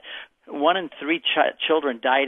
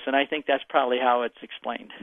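ordinary talk, nothing marked

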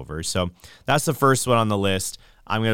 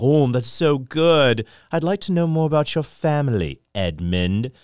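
sly witch voice; 5 semitones higher than usual. Retold or imitated. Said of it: "He's like".

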